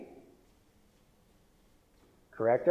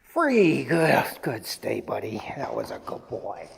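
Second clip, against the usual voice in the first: doting dog praise; 6 semitones lower than usual. Real, not acted. Trainer releasing buddy after a good stay, tender praise. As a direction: release brightly, then settle into tender praise.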